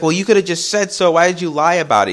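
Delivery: high-pitched mock reproach; upset girlfriend. Mom asks Steve why he lied, upset. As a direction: imitate a hurt woman demanding answers. Mom argues back, retold.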